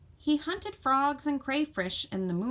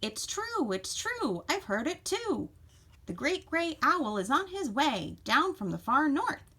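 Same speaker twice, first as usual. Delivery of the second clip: high, bouncing bird voice; chickadee calling out; 6 semitones higher than usual. A narrator's reading. Chickadee spreading news of the owl, high excited calling. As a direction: call out the news in a high chirpy lilt. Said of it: "called out the chickadee-dee-dee".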